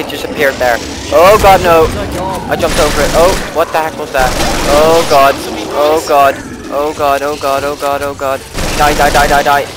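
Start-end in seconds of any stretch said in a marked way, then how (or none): 1.1-9.7 loud, high panicked cries; desperate shouting at monsters; frantic panic. Real, not acted. Fighting zombies up close.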